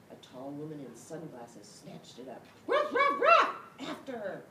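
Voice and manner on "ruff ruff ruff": high loud barks; yapping ruff ruff ruff; barking ruff. Acted. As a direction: bark hard at the thief. Dog barking at thief.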